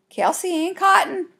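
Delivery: high-pitched calling yell. A narrator's reading. Girl yelling up at sister in the apple tree. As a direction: call the full name up high. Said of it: "I yelled at the backyard apple tree".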